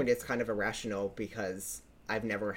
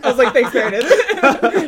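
laughing; 13 semitones higher, 17 dB louder than usual. Retold or imitated. Sarcastic retort in story.

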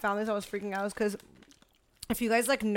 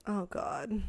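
softer, strained groan while stretching; 7 dB quieter than usual. Real, not acted. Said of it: "sorry, I'm stretching".

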